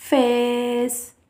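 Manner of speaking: फेस with the vowel held very long and slow; long vowel contrast. Shown as a demonstration. Teacher contrasting the long vowel with the English short one. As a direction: draw out फेस to show the long Hindi-style vowel. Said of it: "इस तरह की लंबी ध्वनि नहीं निकालनी".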